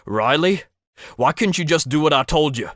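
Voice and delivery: furious, rushed rebuke; angry scolding. Acted. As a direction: blast her for not listening. Hurt man scolds careless worker.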